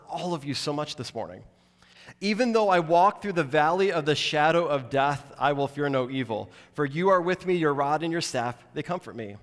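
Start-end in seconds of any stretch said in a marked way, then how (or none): 2.2-9.4 louder, ringing scripture reading; declaimed scripture. Acted. Pastor reading Psalm 23 to congregation, declaimed and louder. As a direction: proclaim the verse to the whole church. Reads verse four aloud.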